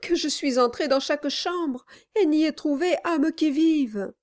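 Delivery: high, frightened wife; trembling. A narrator's reading. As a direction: plead nervously, voice trembling. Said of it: "répliqua sa femme en tremblant".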